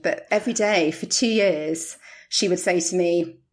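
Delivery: ordinary talk, nothing marked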